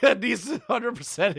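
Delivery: agreeing through a laugh; laughing yes. Real, not acted. Host laughing along with the coach's theory, laughing agreement. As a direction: laugh it out while you agree.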